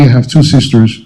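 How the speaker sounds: usual speaking voice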